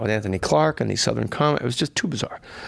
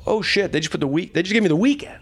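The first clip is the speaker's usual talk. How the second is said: retold excitement; 7 semitones higher than usual. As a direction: let the good news lift your pitch. Retold delight at getting more time.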